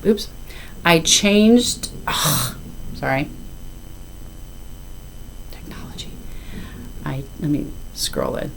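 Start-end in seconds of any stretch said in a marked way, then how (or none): 2.1-8.5 frustrated whisper. Real, not acted. Fumbling with phone mid-video.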